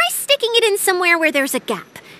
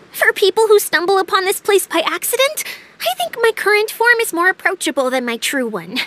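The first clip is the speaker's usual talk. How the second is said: ordinary talk, nothing marked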